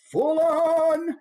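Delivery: high, drawn-out theatrical cry; full-on. Shown as a demonstration. Teacher shows directors your range, going full-on. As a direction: sing out the words at full commitment. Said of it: "if you go partway there".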